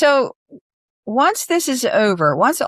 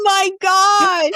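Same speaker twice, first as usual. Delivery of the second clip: high, loud burst of surprise; amazed exclamation; 11 semitones higher, 4 dB louder than usual. Real, not acted. Host hearing about signed poster, shouts in amazement. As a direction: gasp it out, genuinely wowed.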